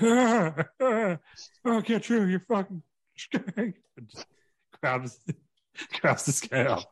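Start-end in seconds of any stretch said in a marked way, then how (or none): 0.0-3.7 high, strained, slow threat; a beaten wrestler groaning out revenge; hammed-up wounded snarl. Bloody Terry Funk crawling from the wire, high and strained.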